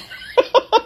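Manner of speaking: a sudden, very high burst of laughter; real laugh at a ridiculous reply. Real, not acted. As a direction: laugh because you can't help it. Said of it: "I couldn't help but laugh".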